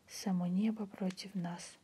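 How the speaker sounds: plain reading voice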